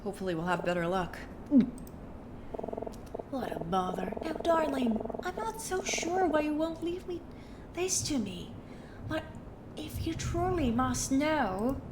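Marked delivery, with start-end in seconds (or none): 3.3-11.8 snooty woman voice. Acted. Vida speaking to player.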